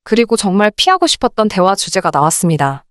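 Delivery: usual speaking voice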